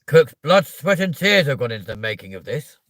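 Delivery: loud, high, commanding tirade from a film villain; Matilda's headmistress. Acted. Ordering a child to finish the chocolate cake, imperious. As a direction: boom it out like a tyrant giving orders. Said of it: "I'll be like".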